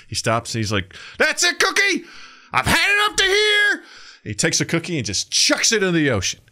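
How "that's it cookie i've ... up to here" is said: very high, strained mock yell; hammed-up furious shout. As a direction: explode in comic exasperation, stretching it out. Man finally explodes at cookie.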